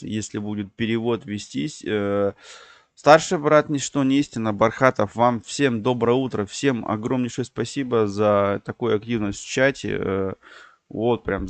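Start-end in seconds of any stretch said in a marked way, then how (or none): none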